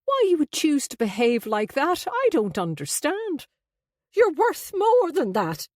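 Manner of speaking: high, wounded petulance; petulant mother scolding her son. A narrator's reading. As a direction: complain petulantly, pitched well up. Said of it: "Abby sounded petulant".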